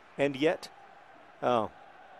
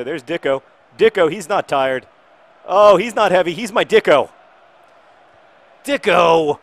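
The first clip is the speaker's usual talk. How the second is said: loud, higher, rising to a shout; excited cheering; 6 semitones higher, 14 dB louder than usual. Real, not acted. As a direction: cheer your player on. Cheering on his striker.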